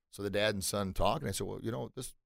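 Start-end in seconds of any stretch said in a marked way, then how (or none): 1.4-2.1 hesitant murmur. Acted. Father and son quietly confer.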